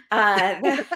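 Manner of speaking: ordinary delivery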